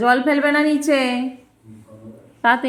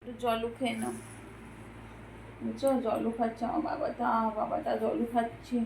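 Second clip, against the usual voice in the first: mother softly cooing over her son drinking water; soft praise; 11 dB quieter than usual. Real, not acted.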